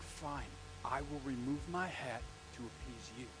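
soft, low, slow mock concession; grudging compliance. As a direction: give in quietly and deliberately. Retold visitor grudgingly complies.

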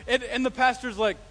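usual speaking voice

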